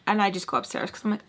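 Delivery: ordinary talk, nothing marked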